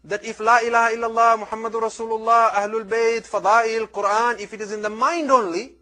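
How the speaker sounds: high, loud, driving sermon climax; fervent preaching. Acted. Preacher driving the lesson home to his listeners, fervent and loud. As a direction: hammer home each sacred name.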